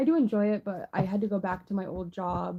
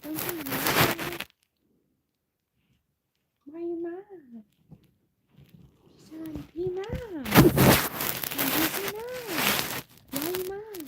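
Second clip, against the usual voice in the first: cooing at the pet; 9 semitones higher, 3 dB louder than usual. Real, not acted. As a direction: soothe the sulking pet in a high coo.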